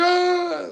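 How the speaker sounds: loud, high mock scream of alarm; imitated alarmed cry. Nurse sees dad scoop up the baby, imitated high alarmed cry. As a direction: let out a panicked "ah" as the nurse.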